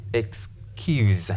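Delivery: "excuse" split, CUSE drawn out; "excuse" as ex... KYOOZ, slow and careful; long second syllable. Shown as a demonstration. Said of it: "The long vowel sound".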